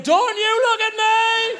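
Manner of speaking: high, slow mock wail; mortified plea. Husband on toilet to wife, mortified. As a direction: stretch it out in high-pitched desperation.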